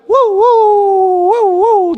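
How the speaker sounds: high, loud imitated dog barks; dog barking. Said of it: "dicen los perros cuando tienen hambre". (ladridos de perro hambriento, agudos y fuertes)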